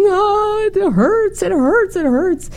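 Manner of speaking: put-on high-pitched repeated child's wail; imitated child crying. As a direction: whine it high like a hurt little kid. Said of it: "she keeps crying".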